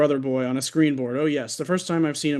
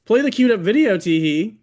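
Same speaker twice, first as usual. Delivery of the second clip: playful surgeon; 7 semitones higher, 6 dB louder than usual. Acted.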